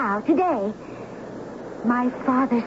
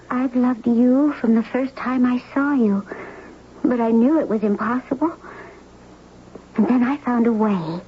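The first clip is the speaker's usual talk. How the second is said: ordinary delivery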